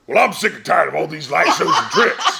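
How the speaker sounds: loud gruff wrestler-villain rant, laughing through it; Mister Satan voice. Retold or imitated. Panel guest imitating his Mister Satan character. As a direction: ham up a cartoon villain. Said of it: "Mister Satan is just".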